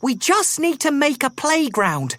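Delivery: high weasel mum, a touch of a sigh; tired little mother, half sighing; weary Mother Weasel. A narrator's reading.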